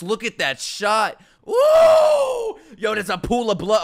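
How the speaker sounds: high, loud whoop of excitement; thrilled shouting. Real, not acted. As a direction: holler at a jaw-dropping shot. Thrilled by a shot on screen.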